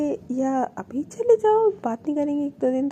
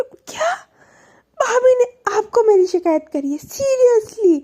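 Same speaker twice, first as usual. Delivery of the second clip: much higher and louder, stretching out the final "Seriously"; shocked disbelief; 8 semitones higher, 5 dB louder than usual. Retold or imitated. Anu to her partner, outraged surprise about the complaint.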